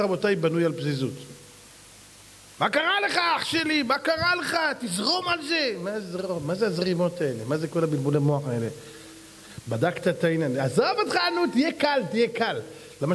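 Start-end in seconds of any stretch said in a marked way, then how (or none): 2.6-5.7 high, shouting street-guy voice; a brash street friend, shouted high; mock street guy. Preacher mocks a street talker. 10.6-12.6 high, pushy street-guy voice; a pushy buddy, pitched high; mock street guy. Mocking the careless advice.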